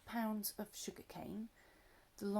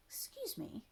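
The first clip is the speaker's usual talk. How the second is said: polite little voice; 10 semitones higher than usual. Acted. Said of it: "most politely".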